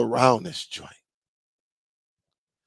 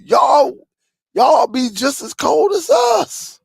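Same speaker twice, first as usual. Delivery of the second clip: teasing shout; 10 semitones higher, slower than usual. Real, not acted. Teasing southern viewers, calling out.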